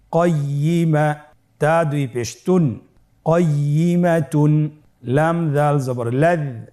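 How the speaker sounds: slow, even chant of letters then syllables; spelling aloud. Shown as a demonstration. Teacher has learners spell along, slow chanted spelling. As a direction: draw out each syllable for repeating. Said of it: "আমার সাথে বানান করে উচ্চারণ করুন".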